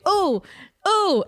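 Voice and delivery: high, excited retold "oh"s; excited outburst. Fan reliving a night at the bar, excited exclamations. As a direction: exclaim in rising delight, reenacting it. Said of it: "I was Leo DiCaprio that entire night".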